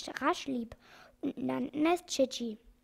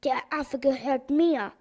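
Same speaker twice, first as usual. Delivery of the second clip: louder, possessive villain demand; storybook villain insisting, louder; angry claim; 6 dB louder than usual. A narrator's reading. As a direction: bark it out as the angry organ grinder. Organ grinder claims monkey.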